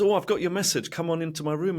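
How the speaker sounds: high, breezy mock boss. Boss calling him into his office, breezy. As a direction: invite him in casually. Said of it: "he goes".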